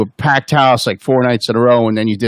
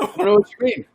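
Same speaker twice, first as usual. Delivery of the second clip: higher, play-dumb innocent voice; mock puzzled voice; 9 semitones higher, 4 dB quieter, faster than usual. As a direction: feign not getting it, pitched up. Mock incredulous voice after joke.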